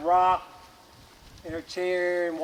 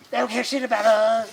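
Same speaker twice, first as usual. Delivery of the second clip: indignant flower; 4 semitones higher, 8 dB louder than usual. Acted. Flowers voiced complaining loudly.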